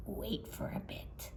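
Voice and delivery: soft sneaky whisper; secret whisper. Acted. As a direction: breathe it like a secret. Lion whispers secret plan step.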